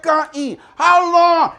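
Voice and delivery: high, loud questioning; sceptical crowd. Retold or imitated. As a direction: shout the doubt. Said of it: "They said".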